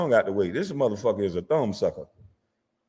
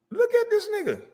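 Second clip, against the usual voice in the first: high, held squeal of disbelief; mock disbelief. Roasting Shannon over the photo, squealing in disbelief. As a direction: stretch it up high, can't believe it.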